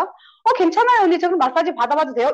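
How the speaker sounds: imitating a friend's willing yes; eagerly willing. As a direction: accept the offer readily, starting high and loud. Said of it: "이렇게 너무 흔쾌히".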